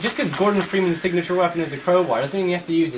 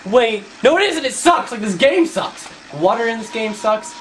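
high, loud burst of annoyance; angry correction; 6 semitones higher, 4 dB louder than usual. Real, not acted. Gamer deciding the game sucks, loud angry rant. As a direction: burst out, dropping the sarcasm. Drops sarcasm to yell.

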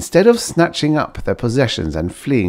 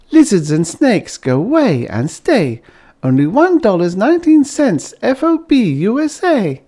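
higher, sing-song label reading; Bliss reading a jingle; 13 semitones higher, 5 dB louder than usual. A narrator's reading. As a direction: chirp the ad rhyme brightly.

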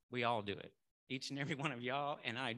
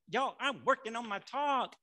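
exasperated protest; 6 semitones higher, 4 dB louder than usual. Retold or imitated. Stressed, fending off a chore request.

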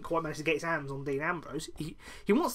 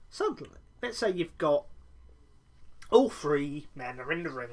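usual speaking voice

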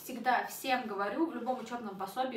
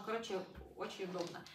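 normal talking voice

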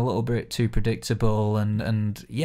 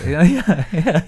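laughing; 6 semitones higher, 6 dB louder, slower than usual. Real, not acted. Laughing after zombie quote.

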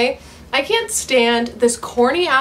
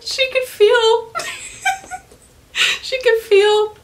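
high, laughing and incredulous; cracking up in disbelief; squeaky laughing repeat; 12 semitones higher than usual. Real, not acted. Laughing disbelief at dragon sex.

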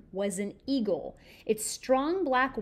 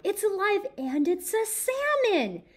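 higher, louder, slowed wonder; awed delight; 9 semitones higher, 7 dB louder than usual. Acted. Simon marvels at the salmon, awed and delighted. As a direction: savour the wonder of it. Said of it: "he thought".

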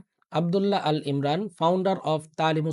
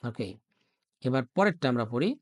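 ordinary talk

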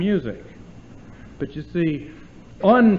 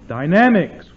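the word dynamics punched much louder; 3 semitones higher, 10 dB louder than usual. Shown as a demonstration. A teacher booming the word to show loudness. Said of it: "which is loud and soft".

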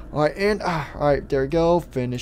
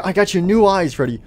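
ordinary delivery

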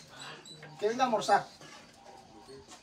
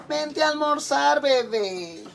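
higher, slow sugary call; doting mother; 6 semitones higher, much slower than usual. Retold or imitated. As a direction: drag it out like a cooing mom. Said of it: "mother calling son again".

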